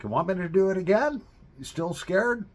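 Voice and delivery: high, loud, quick tease; teasing friend. Retold or imitated. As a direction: toss the offer out eagerly.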